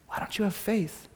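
soft, dropped-down question; quiet challenge. Acted. Pastor asking the church about faith, softly. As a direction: pull it back to a near hush.

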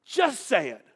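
loud, pressing high-pitched demand; impatient high priest pushing loudly; exasperated demand. Retold or imitated. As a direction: corner him and force the answer out. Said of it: "Caiaphas is like".

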